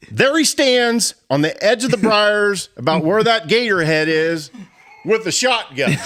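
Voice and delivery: high, laughing telling of the scare; can't stop laughing as he tells it; laughing while telling. Real, not acted. Telling a scary story, laughing.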